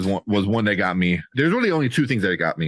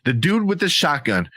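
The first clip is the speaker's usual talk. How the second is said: ordinary talk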